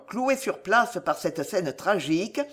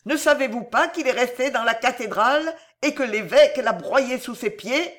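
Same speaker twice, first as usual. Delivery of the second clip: higher-pitched, agitated reproach; madwoman's retort; 6 semitones higher, 4 dB louder than usual. Acted. Madwoman tells cardinal her heart was crushed, higher agitated voice. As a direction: reproach him in a raised pitch.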